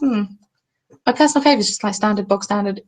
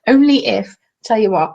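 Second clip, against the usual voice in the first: ordinary delivery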